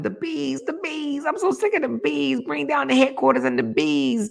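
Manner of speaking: high, squeaky imitation of a man ranting; mock hollering man. Host mocking a man who hollers about bees, squeaky impression. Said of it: "He literally be over there hollering".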